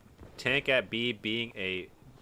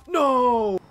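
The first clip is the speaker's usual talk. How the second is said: very high, loud cry of no; anguished shout; 13 semitones higher, 12 dB louder than usual. Real, not acted. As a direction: scream it in dismay. Shocked at a sudden death.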